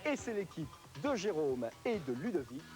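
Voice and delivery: high, excited game commentary; live play-by-play. Acted. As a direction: call the race as it happens, climbing.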